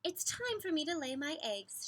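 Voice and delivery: high, bright mother seahorse voice; Missus Seahorse voice. A narrator's reading. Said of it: "she said".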